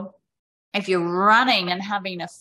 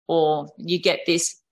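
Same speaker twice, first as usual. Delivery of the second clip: ordinary talk, nothing marked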